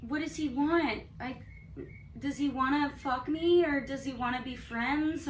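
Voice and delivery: mock ditzy voice wondering what he wants; playing dumb. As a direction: act like a clueless airhead. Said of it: "You're just like".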